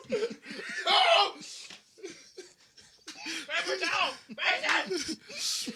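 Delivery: loud, high, laughing yell; laughing shout. Real, not acted. Laughing panic for milk.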